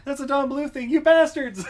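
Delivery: high, loud comic accusation; mock outrage. Viewer accusing animators of copying, mock-outraged. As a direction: accuse them in playful indignation.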